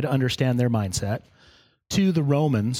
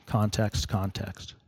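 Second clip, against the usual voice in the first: fading echo; 4 semitones lower, 6 dB quieter, slower than usual. Real, not acted. Preacher repeats key word, fading.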